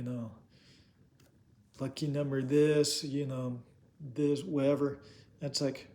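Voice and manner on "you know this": normal talking voice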